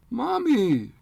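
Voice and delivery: loud, high wail for his mother; a boy on the floor wails for his dead mother; bellowing. Acted. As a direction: howl it out in grief. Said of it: "bellowing for".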